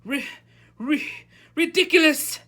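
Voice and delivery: high, stammering squeak bursting out loud; stammer, then squeak out the spell; frightened pupil's high-pitched, halting shout; nervous squeak. A narrator's reading. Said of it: "squeaked Neville".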